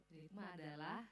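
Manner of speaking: soft, slow, laughing start of the answer; laughing prompt. Shown as a demonstration. Teacher waiting on silent students, laughing softly. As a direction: chuckle through it, waiting for the students. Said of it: "kalau sunyi senyap malam kudus begini".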